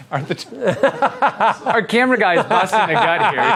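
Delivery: talking through real laughter; can barely get the words out for laughing. Real, not acted. Host cracking up at the camera guy's joke, laughing.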